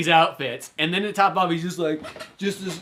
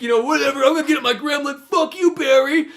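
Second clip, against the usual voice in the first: high, louder mocking punk voice; bratty kiss-off; 9 semitones higher, 5 dB louder than usual. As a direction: flip him off in a whiny screech. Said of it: "he gets greedy".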